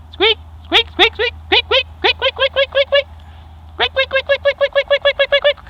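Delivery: very high-pitched rapid squeaking; tiny, piercing repeated squeaks; imitated squeak. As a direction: squeak like a noisy wheel bearing. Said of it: "I noticed a squeak".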